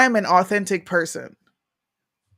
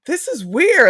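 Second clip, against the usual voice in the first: higher, louder retold freak-out; mock-alarmed, pitch jumping up; 9 semitones higher, 6 dB louder, slower than usual. Retelling her own sudden tears to the host, freaking out. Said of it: "I was freaking out".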